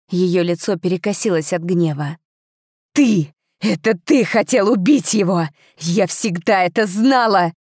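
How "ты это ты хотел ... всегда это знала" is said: furious shout. A narrator's reading. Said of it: "выкрикнула она".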